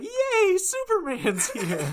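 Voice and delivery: put-on giddy little-boy squeal, very high and loud; mock gleeful cheer, drawn out, breaking into laughter; Batman. Podcaster mocking Batman's reaction to Superman, giddy childish cheer. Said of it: "like a giddy little boy".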